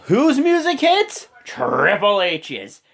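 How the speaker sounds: high, drawn-out announcer-like build; hyped reveal. Retold or imitated. Recapping Triple H's surprise entrance, hyped and drawn out. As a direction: stretch out the big reveal loudly.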